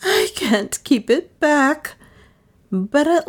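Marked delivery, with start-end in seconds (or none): 0.0-1.9 high, slow hysterical cry; drawn-out, high-pitched hysterics; hysterical sobbing. A narrator's reading. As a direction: wail it out as if losing control. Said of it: "she sobbed hysterically".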